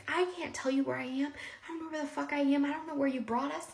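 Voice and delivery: high-pitched, agitated imitation of an ex; retold angry rant. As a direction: rattle off an exasperated retold rant. Ex's angry phone rant retold.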